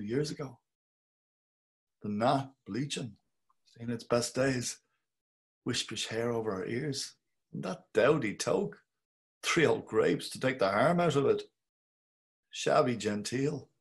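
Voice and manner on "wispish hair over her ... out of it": ordinary delivery, nothing marked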